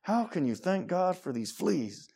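drawn-out retold indignation; mad at sister. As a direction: act out a cross sister without raising volume. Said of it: "mad at her sister".

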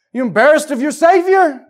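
proud shout. Shown as a demonstration. Preacher shows the church how to shout for Team Jesus, loud and proud. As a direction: belt it out like a proud fan. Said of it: "You ought to be shouting".

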